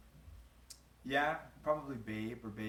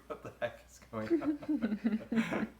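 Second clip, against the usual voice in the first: higher, louder, breaking into laughter; laughing disbelief; 14 semitones higher, 8 dB louder, much slower than usual. Retold or imitated. Man recalling first seeing her puppy mode, laughing disbelief. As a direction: laugh your way through the baffled reaction. Retold amused reaction to her voice.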